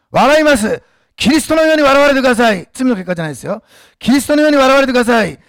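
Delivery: loud, driving sermon exhortation; fiery preaching. Acted. Pastor exhorting the church to be laughed at, preaching loudly. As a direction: preach it out at full force.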